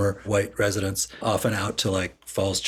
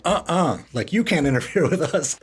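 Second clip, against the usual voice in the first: higher-pitched mock defiance, half laughing; retold defiant refusal; 7 semitones higher, 3 dB louder than usual. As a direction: push back like indignant townsfolk, in jest. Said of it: "the citizens of D C were like".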